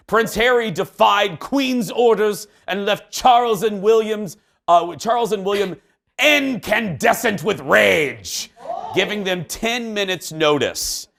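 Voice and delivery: lightly voiced British newsreader; British accent. Host reading tabloid headlines in a light British accent. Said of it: "That was a nice accent".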